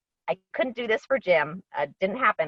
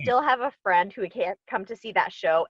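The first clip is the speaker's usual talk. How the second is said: normal talking voice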